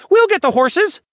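put-on high, loud, eager stable boy; gleeful. A narrator's reading. Stable boy jumps at fetching the horses, gleefully. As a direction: pipe up with boyish glee. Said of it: "announced gleefully".

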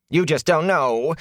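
high whining complaint; whined. A narrator's reading. Peddler complaining to well-fed Rand, whining. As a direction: whine it like a wronged man. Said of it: "Fane whined".

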